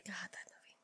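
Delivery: whispered, barely voiced; hushed aside. Real, not acted. Podcast host recalling a disturbing movie, whispered aside. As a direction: breathe it under your breath in dismay.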